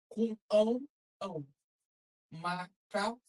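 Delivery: sounding out each letter slowly like a first-grade teacher; spelling out syllables. Shown as a demonstration. Teacher sounding out a word for young children, syllable by syllable. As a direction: break the word into sounds, then blend them. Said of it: "Quando você é criancinha, você vai aprender na escola".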